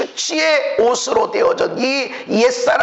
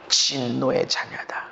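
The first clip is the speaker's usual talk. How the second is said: whisper; 14 semitones lower, 7 dB quieter, slower than usual. Real, not acted. Whispered verdict.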